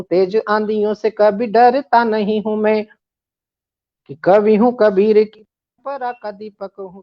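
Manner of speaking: declaimed verse with repeated lines; poem recitation. Acted. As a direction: recite it like a stage poet. Recites another stanza.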